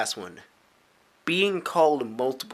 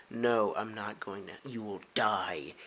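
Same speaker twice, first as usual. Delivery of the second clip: ominous refusal; 4 semitones lower, 7 dB quieter, slower than usual. Pretend. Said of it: "I'm just like".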